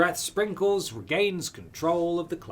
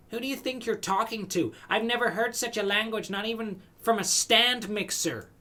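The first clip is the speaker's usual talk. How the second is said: indignant; 7 semitones higher than usual. Acted. Indignant character retort.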